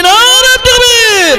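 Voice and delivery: very high, stretched-out rallying cry; soaring, drawn-out takbir shout; forceful takbir call. Real, not acted. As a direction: throw the call high over the crowd, slowly. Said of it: "জোর দিয়ে বলি".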